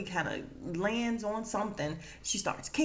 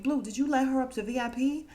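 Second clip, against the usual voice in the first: mock scolding; 6 semitones higher, 5 dB louder than usual. Madam interrogates Blue, acted.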